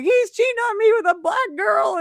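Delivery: high-pitched tearful whine; mock ugly crying. Undercover officer sells her invented story to the suspect, fake crying wail. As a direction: wail it like a put-on jilted girlfriend. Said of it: "ugly crying".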